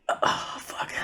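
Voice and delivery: whispered curse under the breath; muttered curse. Acted. Father seeing his son knock too fast, whispered curse. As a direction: mutter it in dread. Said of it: "Tobert's like".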